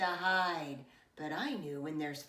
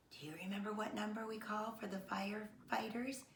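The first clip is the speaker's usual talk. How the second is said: whisper; 3 semitones higher, 6 dB quieter than usual. Acted. Narrator quizzes young listeners.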